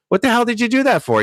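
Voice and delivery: high, fast outraged cry; the frog, stung, yelps in betrayed disbelief; frog's shocked protest. Retold or imitated. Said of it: "he goes".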